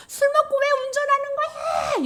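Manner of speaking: high, hammed-up wail of blame; 원망하는 절규. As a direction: wail the accusation, voice way up. Acted resentment at drunk driver. (높게 울부짖으며 원망하는 연기)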